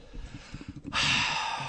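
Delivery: long, breathy sigh; exasperated sigh. Real, not acted. Gives up on explaining.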